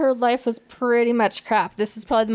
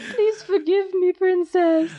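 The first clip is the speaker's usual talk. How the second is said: mock groveling; 6 semitones higher, slower than usual. S1 voices groveling woman.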